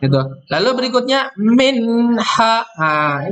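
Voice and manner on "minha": minha chanted with a clear nun, no hum; slow Quranic chant; clear izhar recitation. Shown as a demonstration. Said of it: "ini beda nih".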